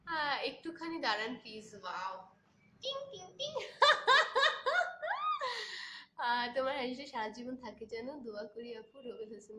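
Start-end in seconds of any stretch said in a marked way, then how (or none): 2.8-3.5 high, light ting-ting; cute bell noise; playful ting sound. Real, not acted. Makes a playful chime noise. 3.6-6.1 high, loud real laugh; laughing out loud at the comment; burst of laughter. Real, not acted. Laughs after chime.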